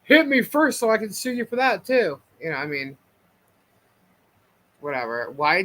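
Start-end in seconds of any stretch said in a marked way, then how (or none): 0.0-2.2 higher-pitched mock taunt; mock-daring, pitched up; egging him on. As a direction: imitate someone goading a rival into a fight. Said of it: "he's also egging him on".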